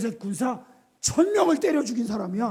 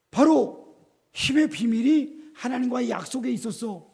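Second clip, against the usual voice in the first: ordinary talk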